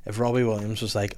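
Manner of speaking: usual speaking voice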